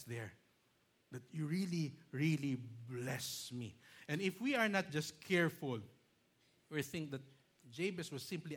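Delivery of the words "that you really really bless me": "really" stretched very slowly; stress on really. Shown as a demonstration. Pastor stressing Jabez's plea, slow "really". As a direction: drag out the second "really". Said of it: "That is how emphatic".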